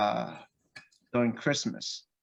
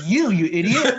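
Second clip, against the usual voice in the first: loud laughing jab, pitched up; laughing mock insult; 8 semitones higher, 10 dB louder than usual. Dad laughingly calls his son an idiot. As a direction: blurt it out through laughter.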